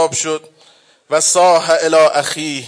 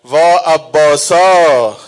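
wailing lament; 3 semitones higher, 5 dB louder, much slower than usual. Acted. Zeinab mourning Abbas, slow loud keening. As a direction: wail for a lost brother.

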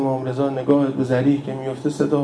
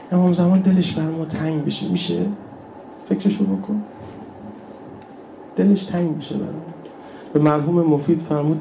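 usual speaking voice

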